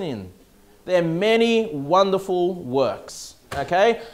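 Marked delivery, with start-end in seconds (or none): none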